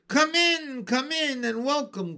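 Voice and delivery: high and loud, a jolly welcome; loud cheery call. A narrator's reading. Uncle welcoming guests from inside, loud and cheery. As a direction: call out happily through the door. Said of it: "called a loud, cheery voice".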